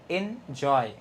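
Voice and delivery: Enjoy in two beats, weight on জয়; stress on second syllable. Shown as a demonstration. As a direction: split enjoy and lean on জয়. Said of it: "জয়টা কিন্তু stressed".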